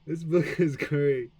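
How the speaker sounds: loud, slow mock enthusiasm; exasperated sarcastic outburst; sarcastic praise. Real, not acted. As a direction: say it like the book is anything but great. Frustrated learner mocks the book.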